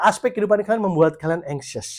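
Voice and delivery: ordinary talk, nothing marked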